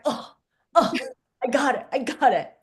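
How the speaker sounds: ordinary talk, nothing marked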